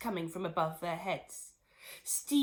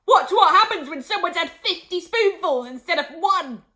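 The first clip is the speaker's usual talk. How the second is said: gleeful shout; 10 semitones higher, 13 dB louder than usual. Acted. Gleeful father hopping about.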